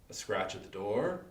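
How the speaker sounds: plain reading voice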